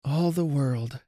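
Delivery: weary, sorrowful musing; sighing murmur. A narrator's reading. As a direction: draw it out like a sigh. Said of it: "murmur with a sigh".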